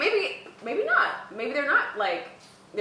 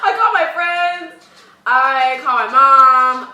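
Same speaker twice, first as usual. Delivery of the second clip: laughing; 3 semitones higher, 13 dB louder, much slower than usual. Real, not acted. Laughing while answering.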